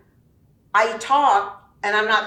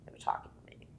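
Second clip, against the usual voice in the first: a voiceless, much softer aside; whispered aside; 17 dB quieter, faster than usual. Real, not acted. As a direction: break off and whisper it. Aside inside the read message.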